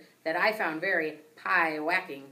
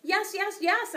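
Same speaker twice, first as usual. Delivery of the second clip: eager squeak; 14 semitones higher, 5 dB louder, slower than usual. A narrator's reading. Hamster agrees.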